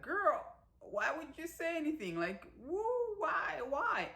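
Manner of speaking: high, loud, squealing why-would-you; shocked disbelief. Real, not acted. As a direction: throw up your hands in disbelief at her. Viewer's shocked reaction to confession.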